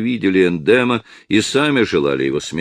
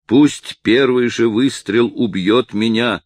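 a slow, loud cry pitched higher; exclaiming; 6 semitones higher, 4 dB louder, slower than usual. A narrator's reading. Old Картина crying out to the conspirators, loud and slow. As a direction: throw yourself before the guns. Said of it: "вскрикнул Картина".

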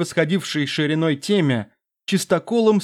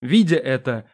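ordinary delivery

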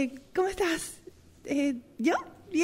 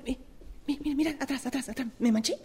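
ordinary talk

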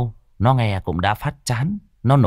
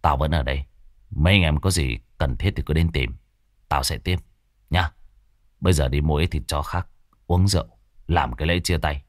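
low, settled voice of a gang member; 6 semitones lower, faster than usual. Acted. Gang member telling the others he stays, low and calm. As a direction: tell them you'll stay put here. Character speaks after splitting plan.

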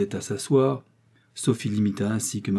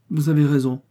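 exclaimed; 5 semitones higher, 5 dB louder than usual. A narrator's reading. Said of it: "s'écria Mister Jenkins".

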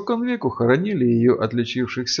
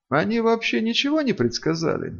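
put-on higher, innocent girlish question; innocent little voice; 10 semitones higher than usual. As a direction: ask sweetly and innocently. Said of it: "невинным голоском осведомилась Рыся". (невинный голосок)

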